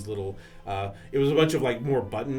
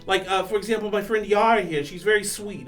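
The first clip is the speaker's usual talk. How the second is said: lightly voiced young man; 10 semitones higher, 8 dB louder than usual. Plays Snark character.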